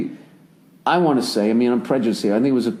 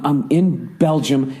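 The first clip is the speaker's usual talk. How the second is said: ordinary talk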